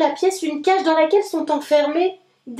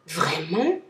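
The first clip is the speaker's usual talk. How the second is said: much lower character exclamation; exclaiming; 7 semitones lower, 3 dB quieter than usual. A narrator's reading. Rabbit exclaims at the trip news, low surprise. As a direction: cry out the word in wonder. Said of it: "s'écrie Lapin".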